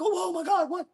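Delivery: high, loud hammed-up freak-out over stolen info; mock panic. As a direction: squeal it like a comic panic attack. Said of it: "I'm like".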